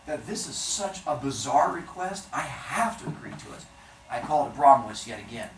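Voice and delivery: ordinary delivery, nothing marked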